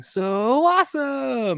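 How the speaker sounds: very slow, high, stretched so awesome; cartoon cat voice. Parent copying the kids' cartoon, a slow, high cartoon voice. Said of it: "I find myself going".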